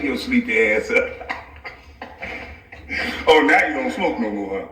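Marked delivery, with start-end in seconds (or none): none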